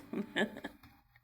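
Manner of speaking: short loud breathy laugh; a quick unvoiced chuckle. Real, not acted. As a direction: laugh it off after the sad part. Laugh after emotional breakdown.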